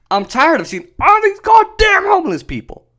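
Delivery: high-pitched, hammed-up exasperated outburst; imitated fed-up rant, voice shooting up; mock exasperation. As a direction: let the pitch soar in pretend outrage. Acts out an imagined blunt statement.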